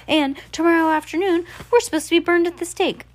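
high, rising alarm at the coming burning; dramatic dread. Acted. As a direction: rise in pitch as the danger dawns. Klaus voicing dread.

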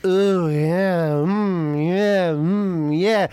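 drawn-out wavering moans; mock pleasure moan. Comic acting out the first slippy pumps, mock moaning. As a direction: moan along in exaggerated, rolling pleasure.

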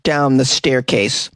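booming slow news-read voice; news parody read. Acted. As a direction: announce it like a tabloid anchor. Reads a news item.

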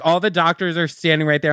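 usual speaking voice

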